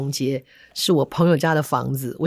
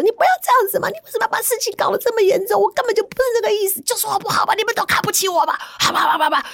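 high, fast, tearful pleading; imitating the wife pleading through tears; crying wife; faster than usual. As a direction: rush the words out as if sobbing. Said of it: "那老婆后来也哭了".

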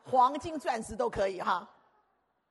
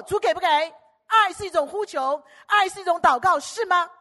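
much higher, loud pleading prayer cry; fervent preaching; 8 semitones higher, 13 dB louder than usual. Acted. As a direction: cry out to the Lord. (高声激昂呼求)

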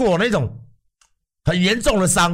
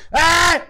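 pained cry; 12 semitones higher, 3 dB louder than usual. Retold or imitated. Continuing the ER screams.

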